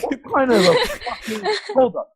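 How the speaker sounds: high laughing disbelief. Real, not acted. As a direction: laugh through the words. Laughing at the swearing supervisor.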